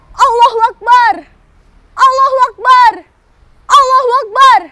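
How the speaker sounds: loud high chant; rallying cry. Acted. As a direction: shout it to the troops. Fighters chant in battle.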